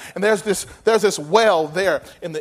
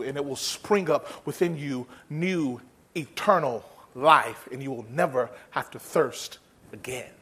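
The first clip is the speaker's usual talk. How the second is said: tender promise; 8 semitones lower, 5 dB quieter than usual. Retold or imitated. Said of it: "Jesus looks at her and says".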